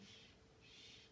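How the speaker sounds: two very soft, unvoiced shushes; whispered shushing. A narrator's reading. Mister Wonka keeps the others silent, soft whispered shushing. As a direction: keep hushing them under your breath. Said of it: "who kept going".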